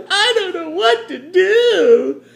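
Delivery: very high, slow, laughing whimper of helplessness; mock helpless girl. Maria Theresa faced with attack, high mock whimper. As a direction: squeak it out like a flustered damsel, giggling. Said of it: "she'll say".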